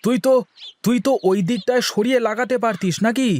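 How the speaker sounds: high-pitched objection in a quarrel; object, put out by the planting; protesting. Acted. Younger brother to elder over where to plant, protesting.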